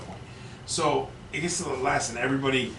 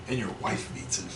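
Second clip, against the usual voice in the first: plain reading voice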